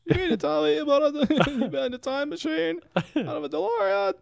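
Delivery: Marty's wide-eyed disbelief; Michael J. Fox voice gone Seinfeld; bad Michael J. Fox. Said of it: "I can't do a Michael J. Fox".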